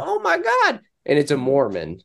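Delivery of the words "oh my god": high shrieking panic; panicked squeal. Retold or imitated. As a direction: squeal it in terror. Hawley panicking again.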